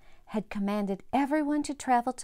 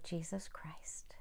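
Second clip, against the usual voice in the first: hushed; 5 semitones lower, 17 dB quieter than usual. Real, not acted. Soft close of explanation.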